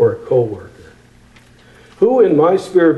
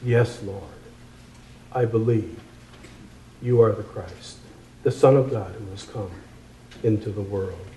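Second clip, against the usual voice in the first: calm reply; 4 semitones lower, 9 dB quieter than usual. Acted.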